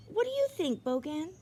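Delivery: Charles asking in a high, eager voice; high child's question. A narrator's reading. Charles asks his sled Bogan, high and eager. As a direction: pitch it way up like a curious little boy.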